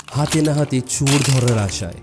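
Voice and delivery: plain reading voice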